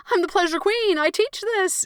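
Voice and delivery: high, whiny protest; mock indignant protest. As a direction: play the offended pleasure queen. Protesting to her body.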